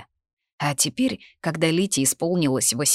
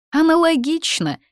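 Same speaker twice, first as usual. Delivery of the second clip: high, loud parting shot; pert, high comeback; tossed-off retort; 13 semitones higher, 6 dB louder than usual. A narrator's reading. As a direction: fling it after her back, pitched up. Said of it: "бросила Дезра ей в спину".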